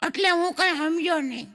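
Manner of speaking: put-on child voice. Child answers father in joke.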